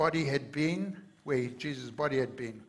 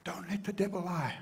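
urgent whisper; 4 semitones higher than usual. Real, not acted. Sermon warning after climax.

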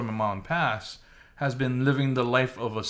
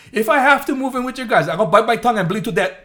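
raised, stubborn dad refusing; voicing his stubborn dad; 7 semitones higher, 6 dB louder than usual. Son mimics stubborn dad, raised defiant refusal. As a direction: insist loudly like a defiant old father. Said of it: "And he goes".

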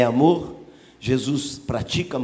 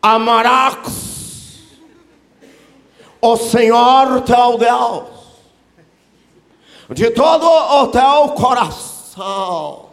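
loud, high, very slow mock-Hebrew declamation; overdone Hebrew pronunciation, proclaimed grandly; mock deep Hebrew; 9 semitones higher, 11 dB louder, much slower than usual. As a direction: stretch each word with a hammed-up Hebrew accent. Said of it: "caprichou no hebraico profundo, com toda a pronúncia".

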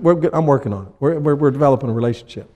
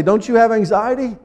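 mock frantic disciples; 6 semitones higher than usual. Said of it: "They had to wake him up and say".

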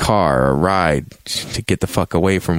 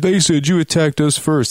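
higher, put-on voice of a hostile stranger; accusing frontiersman; 8 semitones higher than usual. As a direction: mimic the accusing group. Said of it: "they're like".

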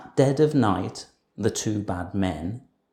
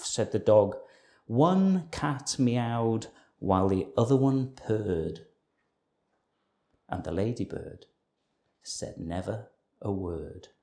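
ordinary delivery

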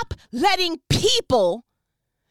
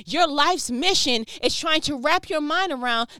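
ordinary talk

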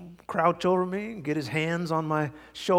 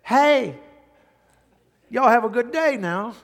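higher, louder hammed-up Southern hello; mock Southern greeting; 6 semitones higher, 7 dB louder than usual. New Yorker greeting an operator in a hammed-up Southern drawl. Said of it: "calling up an operator in New York and then saying".

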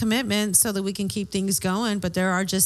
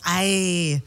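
pirate aye; 3 semitones lower than usual. Real, not acted. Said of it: "Now I sounded like a pirate".

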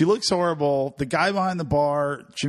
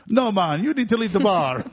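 put-on firm Jamaican accent, higher voice; 6 semitones higher than usual. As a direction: bartender turns her away in a Jamaican accent. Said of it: "That's a pretty good accent".

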